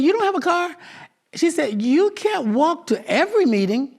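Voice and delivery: imitating a fussing older woman; incredulous woman. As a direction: push back like a caring lady who can't believe it. Woman insists, astonished.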